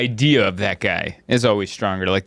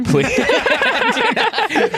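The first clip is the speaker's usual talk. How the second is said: high squeal through laughter; hammed-up desperate plea, cracking up; mock pleading; 3 dB louder, much slower than usual. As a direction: beg in a comic panic. Comic pleading not to be picked.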